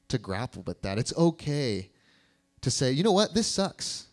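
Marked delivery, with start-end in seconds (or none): 2.9-4.1 fed-up griping. Pretend. Listener voicing their own complaint, exasperated and higher. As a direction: blurt it out like you've had enough. Griping aloud about a hard life.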